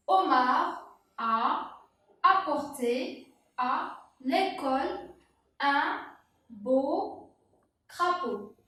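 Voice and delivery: slow, word by word dictation reading; lecture lente. Shown as a demonstration. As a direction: read it out slowly for the child. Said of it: "Je répète la phrase". (lecture lente, mot à mot)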